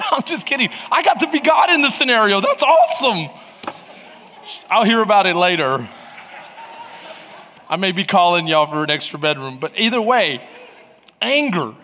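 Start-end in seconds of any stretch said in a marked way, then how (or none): none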